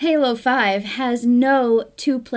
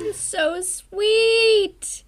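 gushing; 9 semitones higher than usual. Real, not acted. Gushing reaction.

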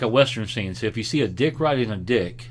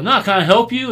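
angry confrontation; 10 semitones higher, 7 dB louder than usual. Retold or imitated. Retold dad confronting stranger.

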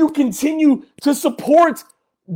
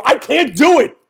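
usual speaking voice

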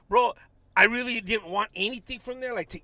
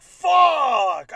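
retold curse; 5 semitones higher, 9 dB louder than usual. Dread at approaching panhandlers.

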